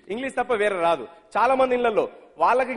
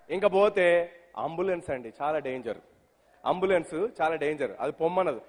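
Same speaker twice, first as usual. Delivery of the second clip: ordinary talk, nothing marked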